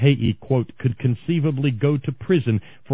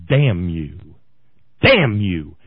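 angry curse; 5 semitones lower, 5 dB louder, slower than usual. Real, not acted. Ranting at Republicans.

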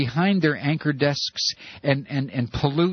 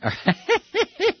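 quick high-pitched cackle. Real, not acted. Host amused by John Dean slip, cackling. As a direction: burst into a high giggle.

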